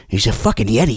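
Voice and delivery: low voice insisting and shutting him down; stubborn believer. Acted. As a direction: brush off the skeptic like a kid. Believer voice returns.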